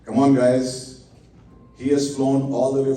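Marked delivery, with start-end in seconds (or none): none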